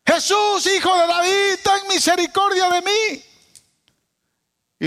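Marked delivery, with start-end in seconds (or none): 0.0-3.2 súplica gritada, mucho más aguda; grito desesperado. Acted. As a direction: clama a Jesús a todo pulmón. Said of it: "Una mujer gritando". (madre desesperada grita a Jesús pidiendo misericordia)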